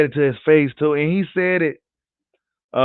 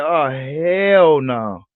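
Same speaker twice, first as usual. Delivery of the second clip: slow drawn-out disbelief; much slower than usual. Retold or imitated. Critics reacting to Bud beating Horn, drawn-out disbelief. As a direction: let "hell no" drag out in disbelief. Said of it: "they was like".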